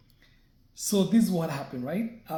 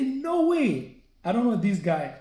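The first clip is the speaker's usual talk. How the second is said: high, loud no way; shocked denial; 5 semitones higher, 5 dB louder, slower than usual. Retold or imitated. Told bank statements were found, shocked denial. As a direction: protest in disbelief.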